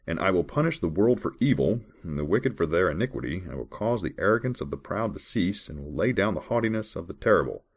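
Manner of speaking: measured, formal Bible reading voice; scripture reading. Acted. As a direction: read the verse like a solemn lesson. Reads Bible verses aloud.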